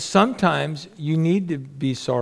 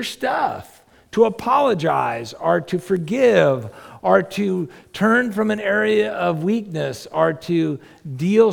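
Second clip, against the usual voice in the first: preaching; 4 semitones higher than usual. Acted. Preaching at full force.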